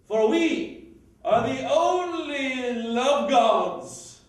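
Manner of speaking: grandly plotting a love match; gleeful scheming matchmaker. Acted. Performs a scheming matchmaker's monologue.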